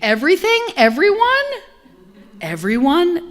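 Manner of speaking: high, loud mock disbelief; incredulous echo. Minister acting out the congregation's shock at loving everyone.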